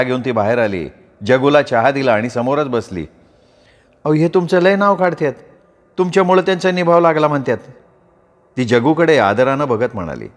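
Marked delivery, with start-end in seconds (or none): 4.0-7.6 higher voice, a bit quick, admiring; respectful village wife. Acted. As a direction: praise the guest like a shy housewife. Wife speaks respectfully to guest. (पाहुण्याचं कौतुक करणारी बायको, उंच आवाज)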